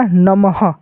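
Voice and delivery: "नमः" with an audible final ha; visarga pronunciation. Shown as a demonstration. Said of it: "उच्चारण करेंगे तो क्या हो जाएगा". ("नमः" में विसर्ग को ह बोलें)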